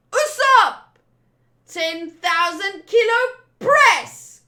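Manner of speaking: high, loud, slow attack yell; attack shout. Acted. Villainess crushing Usopp, a loud high attack yell. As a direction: bellow the attack.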